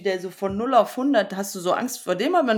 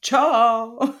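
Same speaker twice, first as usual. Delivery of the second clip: loud, high, drawn-out "Ciao"; big playful finish; playful exclamation; 6 semitones higher, 7 dB louder than usual. Retold or imitated. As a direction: sing out a stretched "Ciao" in mock showmanship. Imitating letter dance finale.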